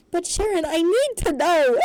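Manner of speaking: loud, high wail; pleading, overblown dad outburst; desperate protest. A narrator's reading. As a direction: whine it at full volume.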